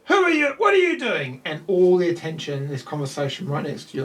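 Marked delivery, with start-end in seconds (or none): 0.0-1.4 high, loud shout of alarm; angry sailor shout. Acted. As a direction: catch the intruder, bark it out.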